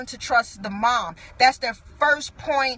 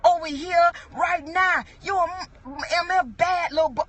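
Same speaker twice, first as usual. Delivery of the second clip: higher-pitched mock yell of a mother; imitated yelling; 5 semitones higher than usual. Mama scolding her child in the street, mock yelling. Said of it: "heard a mama yelling at her child".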